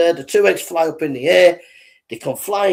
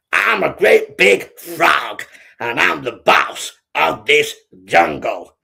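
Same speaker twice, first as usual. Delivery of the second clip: slow, boastful frog boss; bullying big frog; 2 semitones higher, 5 dB louder, slower than usual. Acted. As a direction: drag out each word as a cocky bully. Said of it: "He was a bit of a bully".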